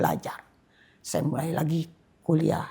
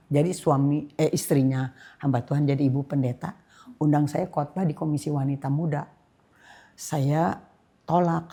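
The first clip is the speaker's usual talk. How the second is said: ordinary delivery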